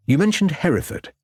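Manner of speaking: high, quick eager pickup; detective seizing a lead, a touch eager. A narrator's reading. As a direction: pounce on the clue. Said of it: "said Ravenscroft eagerly".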